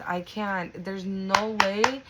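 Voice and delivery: normal talking voice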